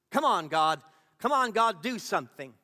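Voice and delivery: loud, urgent cry for help; desperate plea. Acted. Frightened witness pleading with God to act, desperate plea.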